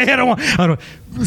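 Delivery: normal talking voice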